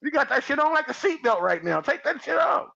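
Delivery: high, laughing mock shout at a guy in a strap-on; mock yelling, laughing. A guy yelling at a strap-on wearer to take it off, high and laughing. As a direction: yell it high through laughter. Acting out confronting man.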